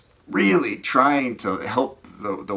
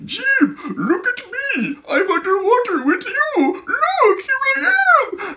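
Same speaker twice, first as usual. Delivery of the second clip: very high, squeaky cartoon voice; mock husband in a piercing falsetto; put-on husband voice; 5 dB louder than usual. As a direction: squeal it eagerly like a show-off husband. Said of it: "I'm gonna do a voice that, that's not French".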